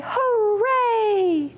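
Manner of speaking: high, bright cheer; cheerful hooray. Shown as a demonstration. Librarian cheers hooray with children, high and joyful. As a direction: whoop it up for the kids. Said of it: "shout hooray".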